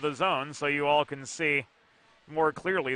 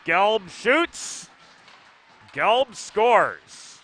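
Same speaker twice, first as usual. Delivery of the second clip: rising, louder goal call; excited goal call; 5 semitones higher, 6 dB louder, slower than usual. Real, not acted. Caller to viewers as the Hens score, excited. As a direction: lift it as the goal goes in.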